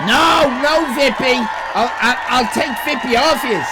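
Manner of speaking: high, loud shout of protest. Real, not acted. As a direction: yell it out in mock outrage. Protesting loudly at chat joke.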